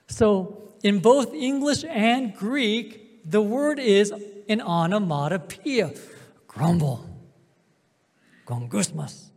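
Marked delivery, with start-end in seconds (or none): none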